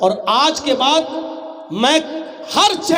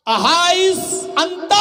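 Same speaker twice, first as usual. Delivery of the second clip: higher, slow, drawn-out call; 7 semitones higher, 3 dB louder, much slower than usual. Retold or imitated. Umar calls after the man with a slow, drawn-out question. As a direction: stretch the question out as if calling across a street. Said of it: "آواز دی".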